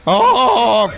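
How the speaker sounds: high, loud mocking echo. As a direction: squeal the word back at him. Mocking the gamer's yell.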